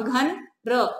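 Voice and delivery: ordinary talk, nothing marked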